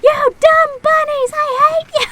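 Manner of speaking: put-on high, full-force child's scream; screaming tantrum. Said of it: "screaming at the top of my lungs".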